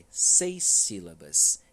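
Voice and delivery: "Seis sílabas" lento e mais alto; devagar e enfático. Shown as a demonstration. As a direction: conte devagar, com ênfase. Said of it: "vamos diminuir aqui". (anunciando devagar em voz mais alta)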